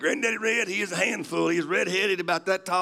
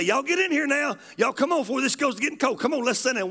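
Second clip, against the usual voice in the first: granddaddy calling to breakfast; 7 semitones higher, faster than usual. Retold or imitated. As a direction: holler the family in to eat. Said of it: "He'd say".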